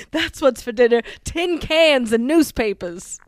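loud, slow New York punchline; old borscht belt comic delivery; Catskill comic. Acted. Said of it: "you go New York".

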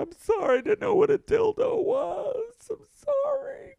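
high, loud, hammed-up sobbing plea; mock crying apology. Boy apologizing to his teacher, hammed-up crying. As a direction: wail the apology like a scared kid.

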